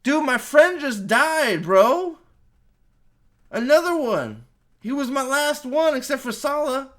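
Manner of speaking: loud, high mock lament; Indy. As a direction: wail it in exaggerated self-pity. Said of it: "And Indy's like".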